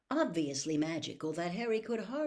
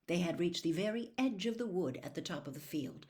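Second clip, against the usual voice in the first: ordinary delivery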